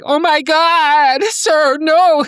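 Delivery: high, loud, drawn-out mock horror; melodramatic nurse. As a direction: wail it in hammed-up shock. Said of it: "She's so melodramatic".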